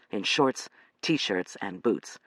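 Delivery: usual speaking voice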